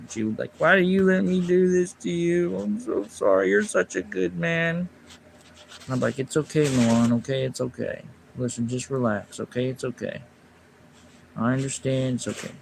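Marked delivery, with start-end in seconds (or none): 0.6-4.9 imitating her crying apology. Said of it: "She would start crying". 6.2-12.5 soft, fading reassurance; soothing reassurance. Retold or imitated. Comforting her after the fight.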